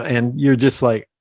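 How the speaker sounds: ordinary talk, nothing marked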